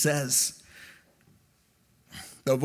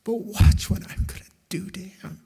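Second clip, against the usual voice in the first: imitating God in a hushed whisper; dramatic whisper; 4 semitones higher than usual. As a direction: whisper it with weight, pausing between phrases. God's tender words, acted in whisper.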